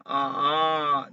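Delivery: "Ah" as a startled rising cry; surprised tone demo. Shown as a demonstration. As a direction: say "Ah" like a sudden surprise. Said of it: "just like when you got surprise".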